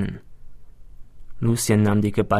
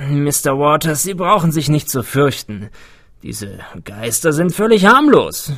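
higher, louder, friendly host voice; genial old man; 4 semitones higher, 5 dB louder than usual. A narrator's reading. Woodstock welcoming wary detective, cheerful and reassuring. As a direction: reassure the nervous guest jovially.

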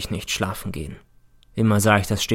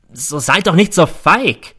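angry call; 6 semitones higher, 5 dB louder than usual. Acted. Teacher challenges silent class.

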